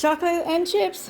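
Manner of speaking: high incredulous question; mock surprise. Real, not acted. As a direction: act shocked at the snack. Reacting to kids' snack.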